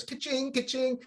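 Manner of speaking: high sing-out of winnings; playful high register chime; imitated cash register. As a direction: mimic a till ringing up money.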